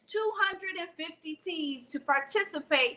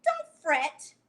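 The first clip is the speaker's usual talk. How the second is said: squealing mouse; much slower than usual. Acted. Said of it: "Mouse squealed".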